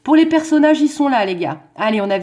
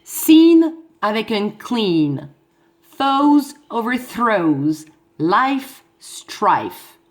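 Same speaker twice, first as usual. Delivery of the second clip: long drawn-out rhyme words "Scene", "clean", "Foes", "overthrows", "Life", "strife"; rhyme demonstration; much slower than usual. Shown as a demonstration. Teacher pointing out rhymes, "Foes overthrows" and "Life strife" drawn out. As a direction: stretch the rhyming vowels in "Scene", "clean", "Life", "strife", very slowly. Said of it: "ça rime avec".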